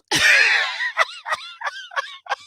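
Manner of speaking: squealing laugh; high shrieking laugh. Real, not acted. Laughing after catchphrase.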